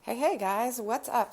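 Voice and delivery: usual speaking voice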